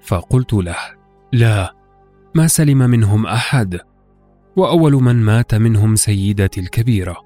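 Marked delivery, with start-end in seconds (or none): none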